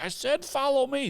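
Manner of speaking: fed-up guide yelling from the woods; imitating an exasperated yell. Guide yelling, flailing arms.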